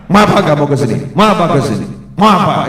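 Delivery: loud, stern, commanding challenge; bold, authoritative. Shown as a demonstration. Right way to face an enemy, loud and authoritative. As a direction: stand firm and demand an answer. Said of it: "Ngadepi musuh tuh yang gagah, wibawa".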